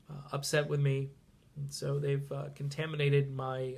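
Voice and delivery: ordinary delivery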